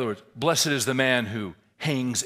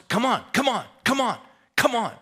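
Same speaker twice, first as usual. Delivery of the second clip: repeated high, loud hollers; yelling coach; 12 semitones higher, 8 dB louder than usual. Retold or imitated. Coach to kid who wants to stop, yelling him on. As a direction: holler him onward, insistently.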